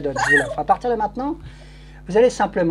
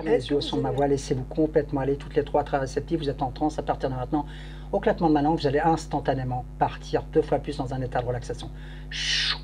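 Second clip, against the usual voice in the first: hypnotic induction; 3 semitones lower, 4 dB quieter than usual. Acted. Hypnotist deepens the trance.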